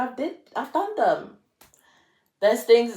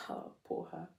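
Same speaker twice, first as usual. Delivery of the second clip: mock pity whisper; 3 semitones lower, 18 dB quieter than usual. Mock pity for past self.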